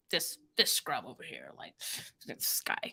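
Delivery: mock dismissive whisper about a rival coach; breathy sneer, barely voiced; imitating Kyle's scornful whisper. Host mimics Kyle sneering at the rival coach, whispered. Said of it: "he looks at and is like".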